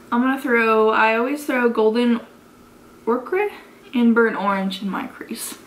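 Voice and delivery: normal talking voice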